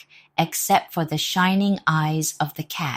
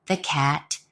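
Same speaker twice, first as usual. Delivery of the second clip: plain reading voice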